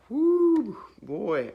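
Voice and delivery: a burst of holy excitement; overwhelmed whoo. Retold or imitated. Speaker sees the dog shirt and feels the Holy Ghost, loud high whoop. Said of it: "And I was just like".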